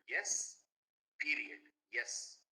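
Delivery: "period" slow and firm, a loud "Yes" on both sides; emphatic. Shown as a demonstration. Teacher showing students an emphatic "period, yes". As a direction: stamp "Yes, period, yes" down hard. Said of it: "you want to tell very emphatically".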